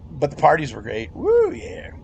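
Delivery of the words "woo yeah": usual speaking voice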